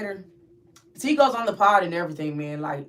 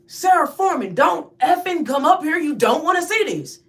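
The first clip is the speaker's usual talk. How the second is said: high, loud, forceful order; urgent shouted warning; 9 semitones higher, 7 dB louder than usual. Retold or imitated. Woman warns friend off the upstairs cell, high urgent shout. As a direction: shout to stop her in her tracks.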